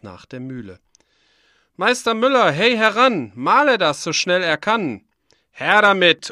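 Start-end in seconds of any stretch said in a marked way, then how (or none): 1.8-5.0 loud, high call across the mill yard; the farmer shouting for the miller. Acted. 5.5-6.3 high, loud "Her damit!"; the miller demanding the sack, loudly; loud bark. Acted. The miller's gruff reply.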